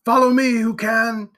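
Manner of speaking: loud, high rallying call; shouting. A narrator's reading. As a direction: shout for others to follow. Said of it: "and shouting".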